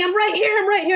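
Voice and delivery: high-pitched cry at ordinary volume; mock scream. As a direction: imitate a desperate scream without the volume. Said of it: "and he's screaming".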